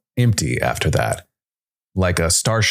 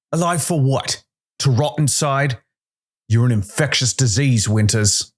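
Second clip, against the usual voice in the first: much higher, furious contempt; rage; 8 semitones higher than usual. A narrator's reading. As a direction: spit it at him in disgust. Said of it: "Calder's rage had clearly never cooled".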